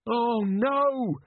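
high, drawn-out wail; despairing moan. A narrator's reading. Captain dismayed by falling debris, high moan. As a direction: moan at a high, sustained pitch. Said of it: "moaned Jenkins".

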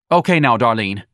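loud, commanding call; bossy Lana. Acted. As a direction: snap her name out firmly. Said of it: "Lana said".

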